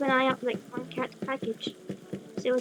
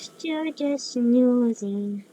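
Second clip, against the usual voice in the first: very slow, drawn-out drowsy voice; sleepy cat; 3 dB louder, much slower than usual. Acted. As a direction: stretch the words like a dozing cat. Voices a snoozing game cat.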